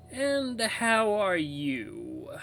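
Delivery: high, very drawn-out, airy woman's voice. Acted. Toy radio repeats itself to the narrator, airy woman's voice. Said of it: "sounds like a woman's voice".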